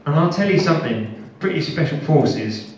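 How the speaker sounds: usual speaking voice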